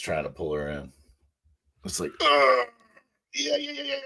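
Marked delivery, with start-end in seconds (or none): 2.2-4.0 high-pitched squeal of effort; straining grunt. Acted. Crewmate pulling her up the ramp, high straining noises. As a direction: strain and squeak while hauling someone in.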